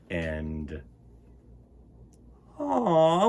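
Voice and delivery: ordinary delivery, nothing marked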